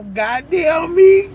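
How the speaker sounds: slow high exclamation; drawn-out exclamation. Real, not acted. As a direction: stretch it out high. Amused exclamation.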